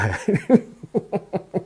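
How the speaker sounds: high laugh at the absurdity; amused laugh. Real, not acted. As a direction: burst out laughing at the nonsense. Laughing at dictionary contradiction.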